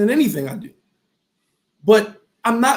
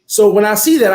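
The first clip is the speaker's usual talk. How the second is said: ordinary delivery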